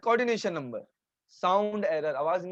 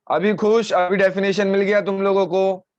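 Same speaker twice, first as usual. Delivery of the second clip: exasperated; 2 semitones higher, 7 dB louder than usual. Real, not acted. Teacher to students who kept asking for the definition, exasperated. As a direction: burst out loudly at the pestering.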